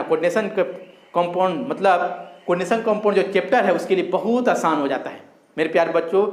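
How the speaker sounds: ordinary delivery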